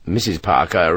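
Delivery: ordinary delivery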